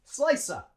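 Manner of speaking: "Slicer" for "slice of", as high-falling "slice-uh"; linked like one word. Shown as a demonstration. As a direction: join "slice" to a short schwa. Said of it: "say it like one word".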